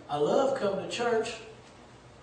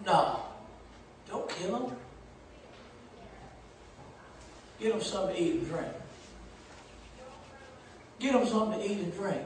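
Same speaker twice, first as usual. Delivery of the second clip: gentle prophet; 12 dB quieter, faster than usual. Retold or imitated. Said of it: "What did the prophet tell him?".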